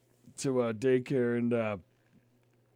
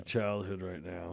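whisper; 10 semitones lower, 11 dB quieter, slower than usual. Real, not acted. Aside about parenting.

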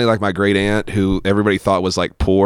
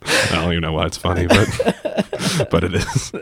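laughing; 2 semitones lower than usual. Real, not acted. Laughing at dark family story.

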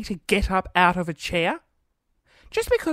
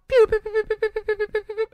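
high-pitched, rapid-fire pew sounds; string of quick high pews; rapid signal pew; 12 semitones higher, 5 dB louder than usual. Retold or imitated. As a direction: imitate a fast electronic crossing beeper. Mimics a repeating signal sound.

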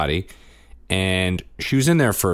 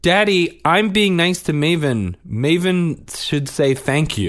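put-on tattling child; 12 semitones higher, 5 dB louder than usual. Son complaining to dad, voiced.

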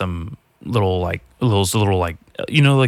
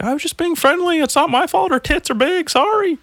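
squeaky falsetto excuse, high-pitched and whiny; high mock excuse. Podcaster imitating a caught flirt, squeaky falsetto excuse. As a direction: play the caught guy in a silly high voice.

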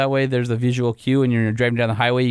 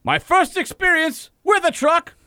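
blustery Southern rooster voice; 7 semitones higher than usual. Acted. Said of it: "are you Southern without the accent".

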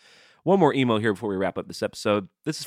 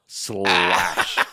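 a sassy, drawn-out "Slash."; mock [name] "Slash."; [name] impression; 5 dB louder than usual. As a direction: give "Slash." some drag-queen flair. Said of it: "say it like [name]".

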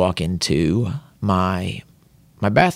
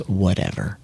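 imitating dismissive wife; 3 semitones lower, 5 dB quieter than usual. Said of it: "She goes".

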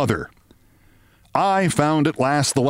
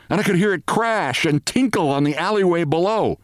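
plain reading voice

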